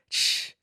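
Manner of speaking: soft drawn-out "shh" sound; imitated shh. Retelling a mishap to the group, imitated shh. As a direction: hush out a quick shh. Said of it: "he's like".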